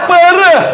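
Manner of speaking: "Parah" high and loud, falling; emphatic "Parah". Shown as a demonstration. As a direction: shout out "Parah" high for the crowd to copy. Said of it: "Coba diulangi gimana?".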